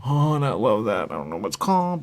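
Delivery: a loud, eager rave over food; getting too excited. Real, not acted. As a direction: gush about the sauce with growing excitement. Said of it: "I'm getting too excited".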